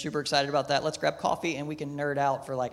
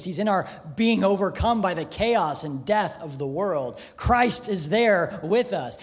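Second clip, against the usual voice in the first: loud, rising sermon climax; full-force preaching; 4 semitones higher, 5 dB louder than usual. Acted. Pastor proclaims God's presence in suffering to the congregation, loud and rising. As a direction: build it up and proclaim it.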